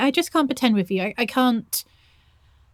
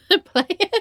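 laughing; 9 semitones higher, 4 dB louder than usual. Acted.

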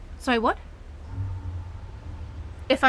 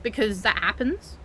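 ordinary talk, nothing marked

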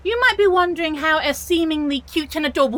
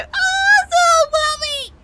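much higher, loud squealing coo, slower on so fluffy; mock cooing admirer; 13 semitones higher, 6 dB louder, much slower than usual. Villain mocking the people who cooed over her fur. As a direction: squeal like a fan gushing over a fluffy pet. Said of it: "makes you want to go".

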